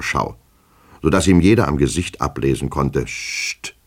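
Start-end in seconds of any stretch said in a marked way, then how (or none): 3.0-3.7 a soft, voiceless shush; hushing. A narrator's reading. An officious clerk warding people off, hushing. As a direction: shush them quickly and softly.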